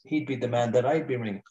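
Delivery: usual speaking voice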